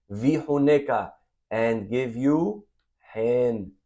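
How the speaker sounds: very slow, drawn-out stress on hen; slow emphasis. Shown as a demonstration. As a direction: lean on hen slowly. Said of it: "hen, big word".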